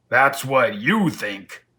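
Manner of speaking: slow, loud menace; menacing lion. Acted. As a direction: let it sink in slowly and loud. Lion scoffs at escape.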